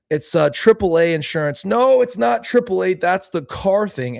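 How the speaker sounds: put-on high, loud muddled old man; older man voice. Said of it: "my older man accent".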